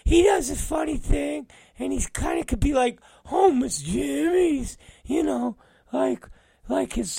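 high, eager falsetto impression; mock him pitching a dumb idea in a squeaky voice; Brandon. Coworker pitching a wrestler to his boss, high mocking falsetto. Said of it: "Brandon has a lot of stupid".

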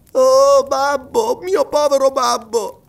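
high, drawn-out cry for father; loud opening wail, then slow and high; anguished boy's exclamation. A narrator's reading. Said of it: "esclamò stringendosi alla sorella".

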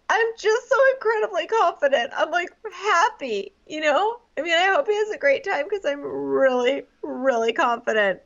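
high-pitched mock self-assurance; Annalise. Annalise insists to the other girls, high mock-confident. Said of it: "Annalise just freaking out".

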